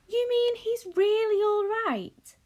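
high, slow, amazed disbelief; Charlie's astonished question to Wonka, high and slow. Acted. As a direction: ask it as if you can't believe it.

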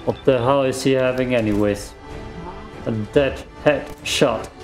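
ordinary delivery

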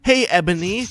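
high shouted hail. A narrator's reading. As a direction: call out to Ebony, high and loud. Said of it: "shouted a voice".

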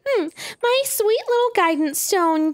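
put-on high, bubbly anime girl voice; excited anime girl. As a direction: gush like a hyper anime researcher. Voicing Purah excitedly.

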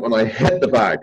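ordinary delivery

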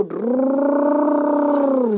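a long rolled-r truck engine drone; rolled engine rumble. Pretend. Playing with toys, sending the dumper truck off with an engine rumble. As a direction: rumble it like a toy truck pulling away.

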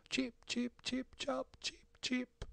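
high, soft little tweets; bird chirping. A narrator's reading. Wounded little bird cheeping inside a church, chirping. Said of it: "se sentì un pigolio".

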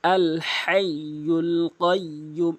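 ordinary delivery

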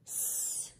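"S" as a soft unvoiced sss hiss; whispered s hiss. Shown as a demonstration. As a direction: breathe out a quiet sss. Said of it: "What do you hear?".

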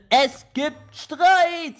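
high, very drawn-out announcement; dramatic announcement. Real, not acted. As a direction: stretch it out to hype the drama. Announces a quarrel in game. (dramatisch gedehnte Ankündigung)

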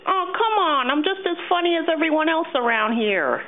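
high, nasally puppet voice. Acted. Puppet retaking a line for Jeff, high nasally voice. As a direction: pitch it way up, through the nose. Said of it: "sounded a bit, uh, nasally".

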